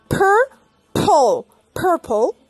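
purple with a puff of air on each p; aspirated P. Shown as a demonstration. Teacher showing the airy p in purple. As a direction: blow out the p in purple. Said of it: "empieza también por P".

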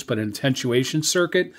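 ordinary talk

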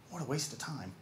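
normal talking voice

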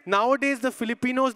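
ordinary talk

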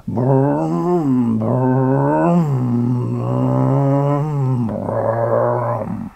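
low steady humming drone; humming brum sound. A narrator's reading. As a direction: hum it like a motor idling. Sound effect in story.

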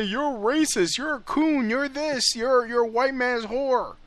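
high mock taunting voice; mimicking the people who throw insults; mocking accusers. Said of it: "That's all they say".

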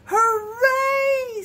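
high, loud, long-held cheer; joyful cheer. A narrator's reading. Bear sees the moon wearing the hat, cheering.

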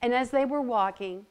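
usual speaking voice

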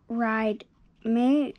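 plain reading voice

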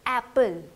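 एप्पल with an open short "a" as in cat; model pronunciation. Shown as a demonstration. Teacher showing pupils the A sound, model pronunciation. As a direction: say एप्पल clearly and firmly, open first vowel. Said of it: "अब एक चीज़ आपने notice करनी है".

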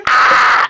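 loud imitated scream of panicking kids; mock kid's scream. Showing friends the kids' breakdown, mock scream. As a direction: scream like a child in meltdown. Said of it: "The kids are like".

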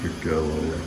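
low, soft murmur of praise; soothing praise. Real, not acted. Dad to Lola wanting belly rubs, low and soothing. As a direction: praise her low and softly.

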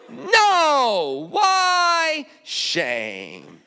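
mock ashamed answer, why squeaked. Repeated shame refrain.

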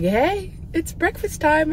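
squeaky pretend dog voice; dog's voice. Owner voicing her dog at his breakfast bowl.

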